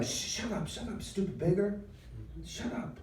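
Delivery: high, sharp shushing at no great volume; hushing rebuke. Acted. Crowd tries to shut up the blind beggar, hushing rebuke. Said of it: "was trying to shut him up".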